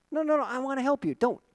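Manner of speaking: high, hurried coaxing; pleading reassurance. Retold or imitated. Preacher trying to help a limping bunny, pleading reassurance. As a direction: plead gently with a frightened animal. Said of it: "I wanted to move toward it to help it".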